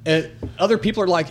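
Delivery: usual speaking voice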